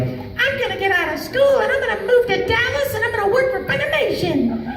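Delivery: high, giddy dreamer voice, laughing; naive fan voice. Retold or imitated. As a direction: mimic an overeager young fan. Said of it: "that are like".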